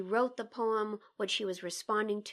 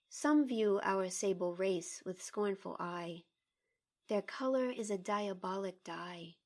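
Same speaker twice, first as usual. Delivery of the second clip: poem reading; 2 semitones lower than usual. Acted. Colleague asked to read the poem, measured poem reading. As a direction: read the poem with care, line by line.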